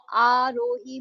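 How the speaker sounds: Aarohi drawn out as आ, रो, ही; syllable by syllable. Shown as a demonstration. Teacher showing three syllables in a name, slowly split. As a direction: give Aarohi three distinct beats. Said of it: "तीन syllable का sound है".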